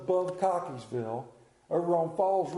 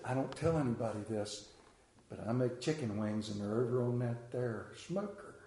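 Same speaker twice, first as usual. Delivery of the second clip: hushed confiding; 8 semitones lower, 7 dB quieter than usual. Retold or imitated. Secret shared confidentially.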